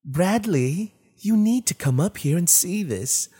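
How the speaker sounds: high, eerie calling voice; strange voice. Acted. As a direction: call him from the dark upstairs. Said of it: "a strange voice spoke".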